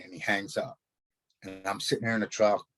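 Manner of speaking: usual speaking voice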